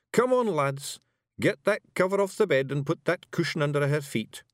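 rowdy little Scots pixie voice; broad Scottish accent. Acted. Rob gives orders.

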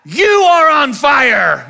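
high, loud and slow cry; alarmed yell. Retold or imitated. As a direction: drag out the warning to dad. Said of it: "And I'm like".

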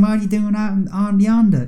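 mock the teacher's bad southern accent reading the book; pathetic southern accent. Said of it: "doing this pathetic southern accent".

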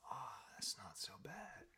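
slow, airy sigh of relief; relaxed. Acted. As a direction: let it out slowly, boneless after a puff. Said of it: "just relaxes, goes".

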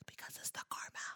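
hushed unvoiced whisper. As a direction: breathe it like a secret. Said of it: "Why you whispering?".

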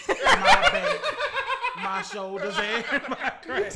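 high laughing, words breaking up; cracking up mid-sentence. Real, not acted. Mocking Ben Simmons' injuries.

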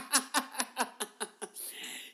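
high, loud burst of laughter; loud cackle. Real, not acted. Comic telling of being haunted by that evil cow, loud cackle. Laughing at her own ghost story.

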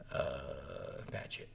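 low, hushed mumbled admission; subdued reply. Acted. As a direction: answer very softly and low, hesitant. Answering the soldier, acted reply.

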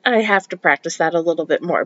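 ordinary talk, nothing marked

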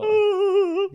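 very high, loud attempted creature call; strained, botched Wookiee noise; Chewbacca. Shown as a demonstration. Showing friends his Chewbacca accent, high failed Wookiee call. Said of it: "Go for it now".

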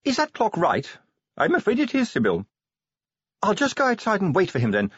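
high-pitched anxious lady; Sybil's voice. A narrator's reading. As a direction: keep Sybil up high and brisk. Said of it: "said Lady Sybil".

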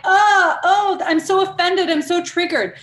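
high, loud, hammed-up whine of offended people; mock the easily offended, loud and high. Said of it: "Everything is like".

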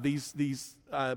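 ordinary delivery

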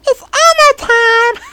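very high puppet squeal with giggle; Elmo. Acted. Showing off a puppet impression, piercingly high falsetto. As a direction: squeak like a children's puppet, then laugh. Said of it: "I thought I had Elmo down".

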